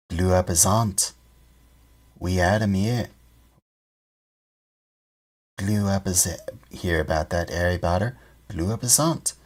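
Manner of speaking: lightly voiced gossiping bus conductor; a hint of eager young conductor; Stan's voice. Stan gossiping to Harry on the bus, lightly voiced.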